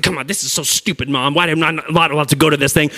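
a hint of a kid complaining to mom, huffing; huffy whiny kid. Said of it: "all huffy and puffy".

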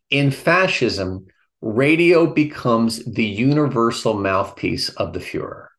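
ordinary talk, nothing marked

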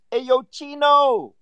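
loud, very high drawn-out holler; imitated street call. Storyteller voicing locals calling him in Tijuana, loud mocking holler. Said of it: "they kept calling me".

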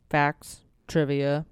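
halting, slow monotone list; malfunctioning robot. Real, not acted. Asking listeners for Poe trivia, like a glitching robot. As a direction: stall between words, voice sinking. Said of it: "You sound like a robot who's malfunctioning".

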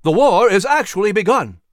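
loud ringing statement; forceful declaration. Acted. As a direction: hammer the words home. Patrick's oration.

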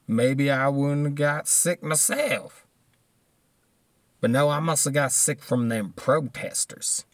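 slow, drawn-out sarcastic imitation; mock denier voice. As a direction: drag out the words, mocking their excuse. Mimics deniers blaming protesters, drawled.

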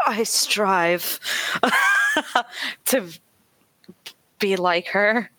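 ordinary delivery

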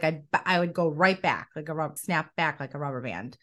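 ordinary delivery

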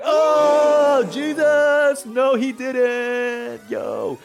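loud, high, drawn-out hollering; hammed-up "oh no he didn't" holler; hyped onlookers. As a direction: heckle from the back like a scandalized crowd. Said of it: "disciples in the background like".

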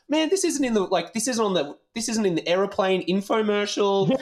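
very high, whiny disbelief; retold complaint in a squeaky, worked-up pitch; indignant protest. Tourist told his shirt is wrong, high-pitched protest.

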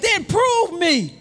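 slow, booming voice of God; slow thundering divine command; preaching God's voice. Retold or imitated. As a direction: proclaim it slowly with full force. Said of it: "He said".

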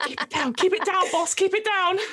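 quickly calm the boss down; hushing sidekick. Acted. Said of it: "Banana helps, just like".

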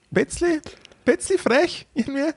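squeaky high, coy little voice; bashful falsetto mumble. Retold or imitated. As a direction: go way up and play it shy. Coy answer to flirty question.

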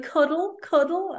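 put-on high, rising little-child plea; toddler voice. Toddler asking his mum for a cuddle, high and sweet. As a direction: ask sweetly like a two-year-old.

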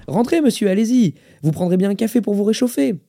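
put-on high-pitched, eager old host; lifted, hospitable invitation; eager welcome. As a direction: usher a guest in eagerly. Old man welcomes his visitor.

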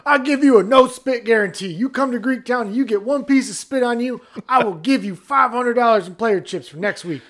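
a hint of a southern accent making the guarantee; southern pitchman. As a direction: promise it like a folksy huckster. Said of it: "southern accent".

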